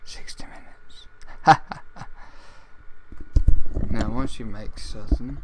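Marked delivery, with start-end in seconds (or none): 0.0-1.1 breathy whispered aside; whispered correction. Acted. Whispered aside after announcer line. 1.1-3.9 big theatrical laugh, loud and breathy; loud hammed-up laugh to close the intro; loud villain laugh. Laugh after recorded intro.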